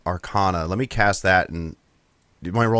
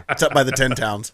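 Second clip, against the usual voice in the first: normal talking voice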